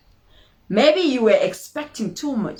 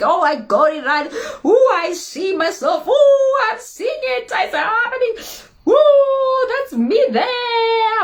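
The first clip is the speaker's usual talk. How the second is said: much higher, louder squeals of delight; giddy excitement; 14 semitones higher, 5 dB louder than usual. Pretend. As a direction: gush like you can already see the dream. Picturing imagined success.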